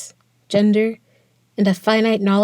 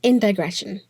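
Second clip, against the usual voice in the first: ordinary talk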